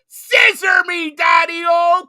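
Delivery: high, slow, yelled wrestling promo; screaming the catchphrase at the top of the lungs; wrestler catchphrase. Retold or imitated. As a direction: belt it out like a wrestling legend on the mic. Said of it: "it's the whole".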